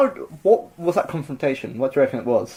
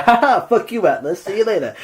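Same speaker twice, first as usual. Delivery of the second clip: gloating taunt; 6 semitones higher than usual. Retold or imitated.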